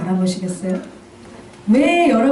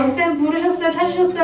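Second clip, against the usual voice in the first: higher, eager polite calling; polite call to pastor; 6 semitones higher than usual. Retold or imitated. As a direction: call out to the pastor with raised pitch.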